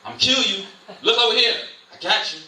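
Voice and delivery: Satan jeering and shouting at the distracted believer; Satan taunting. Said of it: "that's when Satan got you".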